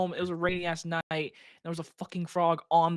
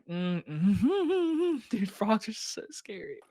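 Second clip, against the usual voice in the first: nervous; 5 semitones higher, much slower than usual. Real, not acted. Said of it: "wanna pee my pants right now".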